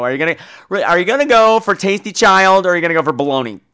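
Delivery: usual speaking voice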